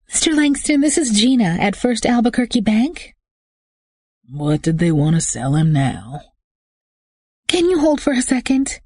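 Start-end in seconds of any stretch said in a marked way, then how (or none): 0.1-3.1 higher female receptionist voice; bank clerk. A narrator's reading. Bank caller Gina phoning the husband, polite and higher. 7.5-8.8 higher female receptionist voice; bank clerk. A narrator's reading. Bank caller Gina phoning the husband, polite and higher.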